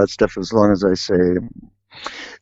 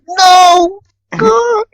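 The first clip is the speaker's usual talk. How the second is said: much higher and loud, pained; anguished cry; 6 dB louder than usual. Retold or imitated. Begging the dealer to stop in a high anguished cry. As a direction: cry out in dismay. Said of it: "I said".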